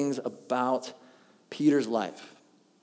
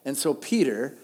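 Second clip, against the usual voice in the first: usual speaking voice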